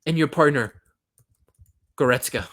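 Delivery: explosive retort; angry outburst. Acted. Outburst at the player's critics.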